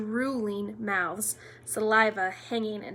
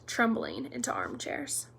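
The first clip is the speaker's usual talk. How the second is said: usual speaking voice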